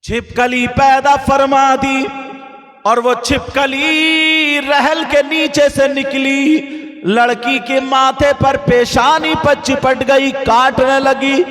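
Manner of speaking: loud, sung-out sermon chant with music; preaching at full force. Acted. Preacher telling the village girls a warning tale, in a loud sung-out chant. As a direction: preach it to the gathering, chanting the lines.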